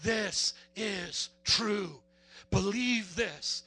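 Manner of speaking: very slow, weighted declaration; slow, deliberate emphasis on "This is true. Believe this"; emphatic. Retold or imitated. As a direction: land every word of "This is true" separately. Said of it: "he's emphatically saying".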